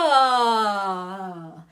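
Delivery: a loud ah gliding through the range; vocal swoop. Shown as a demonstration. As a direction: sweep the voice on one long ah. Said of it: "it allows you to do a swoop".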